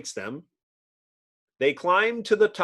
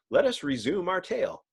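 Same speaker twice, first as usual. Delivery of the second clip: ordinary talk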